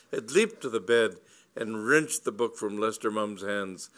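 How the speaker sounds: ordinary delivery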